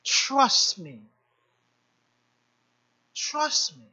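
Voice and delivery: low, soft, gentle voice of God; God reassuring. Retold or imitated. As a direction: speak low and hushed, like a calm father. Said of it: "And God is like".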